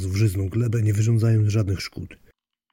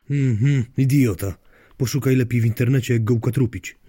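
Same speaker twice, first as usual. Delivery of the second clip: mildly irritated, louder and higher; annoyed wife; 4 semitones higher, 6 dB louder than usual. Acted. Wife tells unwilling husband to search online, mildly annoyed. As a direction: push the order out with a touch of exasperation.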